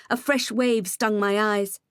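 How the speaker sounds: ordinary delivery, nothing marked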